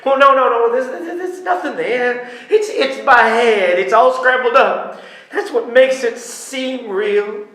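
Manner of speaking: loud scattered pleading; frantic loud denial. Acted. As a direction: protest in rising panic.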